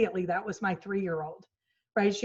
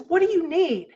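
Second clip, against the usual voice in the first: higher, louder curt demand; irritated snap; 8 semitones higher, 6 dB louder than usual. Shown as a demonstration. Mom imagining snapping at her daughter, irritated. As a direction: snap impatiently. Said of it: "what I would have said is".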